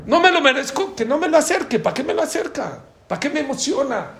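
frustrated complaint. Retold or imitated. Voicing the angry friend's complaint.

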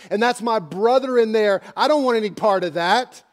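a loud, upset Martha; Martha protesting. Acted. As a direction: push back hard against opening the tomb. Martha protests rolling stone away.

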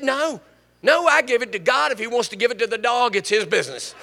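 retold woman firmly waving off the offer; emphatic refusal. Woman telling the preacher she gave it to God, emphatic.

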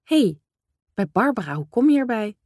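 mock surprise. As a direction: bristle at the idea, pitch jumping up. Mother reacts to father's suggestion.

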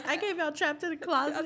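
high, laughing boast. Retold or imitated. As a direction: brag it up high mid-laugh. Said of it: "He goes".

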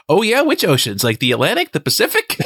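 high sarcastic questions; mocking. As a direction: needle him with fake curiosity. Mocking reply as a character.

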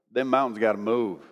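low, drawn-out Southern twang. Retold or imitated. Cowboy to his boss about the mountains, drawn-out Southern twang. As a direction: draw it out in a deep Southern twang. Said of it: "drawn-out Southern twang".

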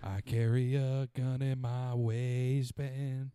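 drawn-out, slow hammed-up delivery; slow mock declaration. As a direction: stretch each word in mock pride. Mock patriotic line, drawn out.

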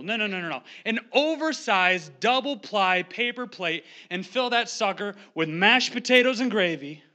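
normal talking voice

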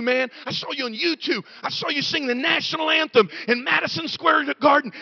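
high, breathless fan gushing over the pastor, building to a shout. Retold or imitated. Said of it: "People come up to me".

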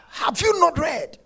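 high, slow shouted question; loud call. Real, not acted. Preacher calling out to the congregation, loud and slow. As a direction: demand it back from the crowd. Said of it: "Somebody say".

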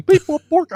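high, loud robot noises; robot beeps. Retold or imitated. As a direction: beep like a silly computer. Imitates booking engine.

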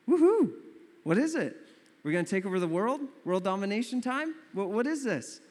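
high, loud whoop into eager questions; hammed-up eager followers; mock excitement. Disciples hearing their teacher has a new command, mock excitement.

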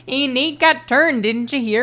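gossipy mock Yooper; Yooper accent. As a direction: pass on news in a Yooper accent. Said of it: "fits really well into the Yooper thing".

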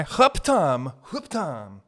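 exaggerated caricature Chinese accent; mock Chinese accent. Comedian mimicking an optometrist, mock Chinese accent. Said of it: "Chinese guy".

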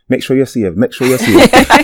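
mock Jamaican accent, rising; light Jamaican accent attempt. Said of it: "I did it in a Jamaican accent".

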